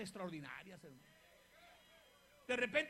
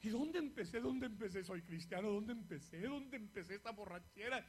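dazed dreamer; 3 semitones lower, 6 dB quieter than usual. Retold or imitated. Retelling a drunken dream.

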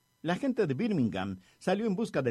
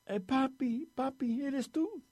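put-on very high, small little-boy voice; tiny child's voice; 12 semitones higher, slower than usual. A narrator's reading. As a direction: squeak it out slowly like a small lost child. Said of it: "salió una pequeña vocecita". (vocecita aguda de niño pequeño)

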